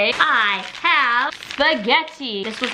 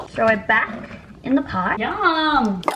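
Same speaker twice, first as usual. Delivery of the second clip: normal talking voice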